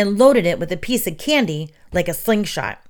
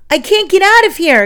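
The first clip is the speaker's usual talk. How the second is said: much higher, louder panic; panicked cry; 11 semitones higher, 8 dB louder than usual. A narrator's reading. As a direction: scream while straining against the web. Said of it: "Glenn cried as he struggled".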